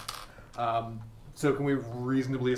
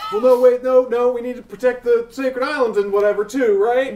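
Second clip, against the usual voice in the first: alarmed protest; 10 semitones higher, 11 dB louder than usual. Real, not acted. Player alarmed objection.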